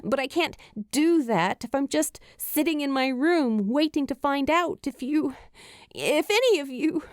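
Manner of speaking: put-on a frightened girl pushing her words out before her voice breaks; Julie, anxious and pleading. Julie fears for him, voice catching.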